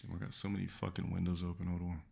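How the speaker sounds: ordinary delivery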